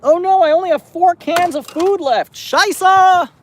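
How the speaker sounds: high, loud comic alarm; mock dismay. Cat feeder finding only four cans left, mock dismay. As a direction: cry out in hammed-up panic, stretching the curse. Discovers cat food running out.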